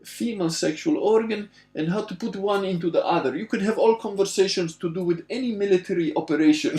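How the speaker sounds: put-on high-pitched, emphatic Russian accent. Latvian friend tells Giuseppe his Russian is enough, Russian accent. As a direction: imitate an insistent friend in a thick Russian accent. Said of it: "It's a good Russian accent".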